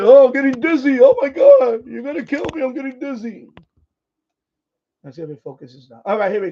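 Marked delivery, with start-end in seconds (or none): 0.0-3.5 higher, louder whiny mock complaint; hammed-up panicky viewer; mock dizzy viewer. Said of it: "Don't say".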